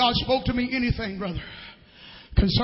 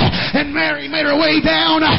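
higher and louder, a catch of crying; impassioned preaching; 4 semitones higher, 5 dB louder than usual. Acted. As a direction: preach it with tears near the surface. Peter and Mary story preached.

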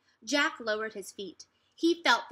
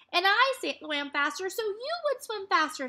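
amazed echo; 9 semitones higher than usual. A narrator's reading. Annie echoing, amazed.

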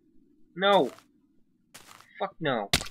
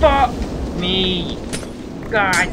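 loud high burst of frustration; loud frustrated outburst; exasperated shout; 5 semitones higher, 7 dB louder, much slower than usual. Real, not acted. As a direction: yell out in disbelief. Tornado hits.

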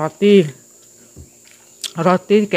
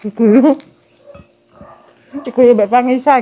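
excited; 6 semitones higher, 5 dB louder than usual. Real, not acted. Repeats being laughed at, louder.

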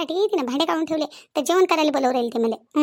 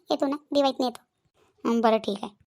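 ordinary talk, nothing marked

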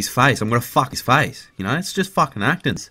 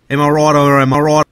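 loud rallying call; 7 dB louder than usual. Acted.

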